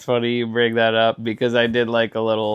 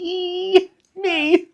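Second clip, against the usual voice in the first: squeaky high voice. Retold or imitated. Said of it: "It's like".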